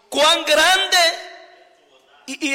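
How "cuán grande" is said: loud, higher cry of wonder on Cuán grande; exclaimed. Shown as a demonstration. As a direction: shout it out in awe. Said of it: "signo de admiración". (exclamación admirada en voz alta)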